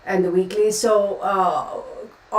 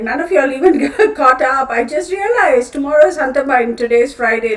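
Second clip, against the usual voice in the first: laughing; 5 semitones higher, 8 dB louder than usual. Real, not acted. Laughs at her own blunder.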